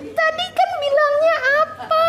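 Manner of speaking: high-pitched exasperated telling-off; mock angry scolding. Mother scolds child in the supermarket for breaking a promise, mock angry. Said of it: "mau marah".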